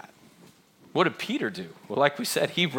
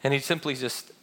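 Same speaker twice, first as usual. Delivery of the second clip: normal talking voice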